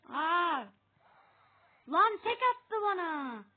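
loud, high outraged yell; real angry outburst; frustrated shout. Real, not acted. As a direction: shout at the one-shot kill. Killed in one shot.

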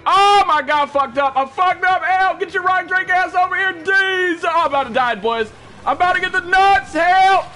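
high, loud cries for help; frantic shouting for help; panicked yelling. Real, not acted. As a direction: yell for backup in real panic. Cornered and calling for help.

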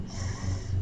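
"S" as a long voiceless hiss, no vowel; just hiss the "S". Shown as a demonstration. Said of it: "It's the S".

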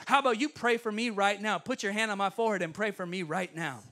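loud taunting challenge; loud jeer that eases off slightly; mocking. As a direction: imitate a heckler's mock request. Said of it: "He's mocking me".

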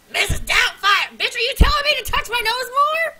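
loud, high shocked yell; startled cry at her reflection; laughing shout. Real, not acted. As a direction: shout at the screen, half laughing. Shocked at own mask face.

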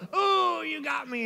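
high, drawn-out cry, falling away; hammed-up cartoon death cry; mock dying victim. Induction mate pretending to be shot, melodramatic. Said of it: "You'd hear him go".

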